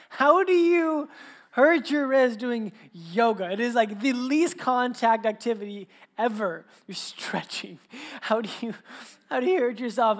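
mock amazed, can't believe it; incredulous dad. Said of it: "I was like".

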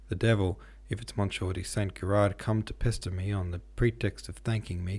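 ordinary delivery, nothing marked

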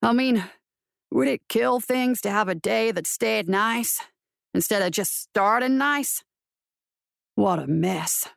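slow, weary western lawman complaining; grumbling marshal. Acted. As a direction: drawl it out as a put-upon frontier marshal. Narrator voices the marshal grumbling.

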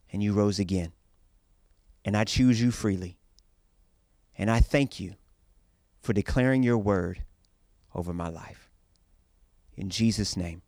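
earnest, steady prayer voice. Acted. Leads a prayer.

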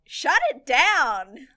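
loud, slow, fed-up outburst; exasperated yell. Retold or imitated. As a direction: shout it at the screen. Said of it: "I was just sitting there going".

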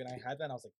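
ordinary talk, nothing marked

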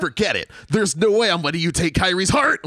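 impassioned game-hero line read, loud and high; mimicked overwrought voice acting; Sora. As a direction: belt out a defiant line, rising like a question at the end. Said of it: "I can remember the intonation of the line read".

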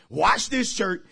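normal talking voice

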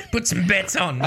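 high laughing Aussie punter voice; Australian accent. Retold or imitated. Friend joining the joke, laughing Australian accent. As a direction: call out "epitaph" like an Aussie racetrack. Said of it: "very much more Australian".

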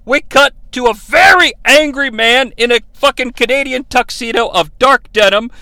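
normal talking voice

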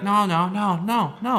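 mock refusal.

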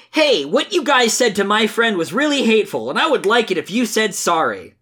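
high, sing-song earnest Sonic. Acted. Reader voices Sonic defending his friend, high and earnest. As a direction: preach friendship in a high, naive hero voice. Reads Sonic's comic lines.

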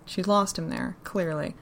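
usual speaking voice

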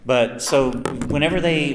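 normal talking voice

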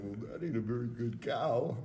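imitating a cracking voice. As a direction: crack the voice mid-line. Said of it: "his voice cracked a little".

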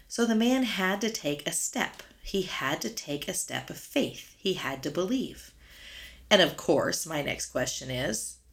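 normal talking voice

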